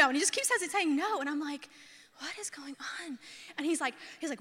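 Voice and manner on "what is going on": hushed, voiceless bewilderment; baffled whisper. Retold or imitated. Woman watching fiance walk right past her, baffled whisper. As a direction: whisper it to yourself in disbelief.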